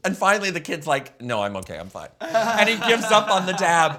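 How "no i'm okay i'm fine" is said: lower, softer, a little sheepish; low mumbled kid reply. Retold or imitated. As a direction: play the busted kid, low and quiet. Voicing the kid giving up.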